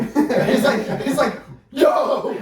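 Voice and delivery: high, loud "yo"; laughing shout. Retold or imitated. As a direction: yell it out laughing.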